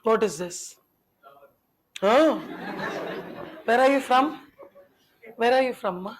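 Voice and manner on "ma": usual speaking voice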